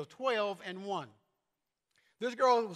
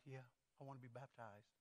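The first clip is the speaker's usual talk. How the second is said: retold in a low, much softer voice; 9 semitones lower, 23 dB quieter than usual. Vincent telling Rhonda he wants baptism, low and quiet. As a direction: answer low and barely audible, gently admitting it. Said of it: "And he said in a low voice".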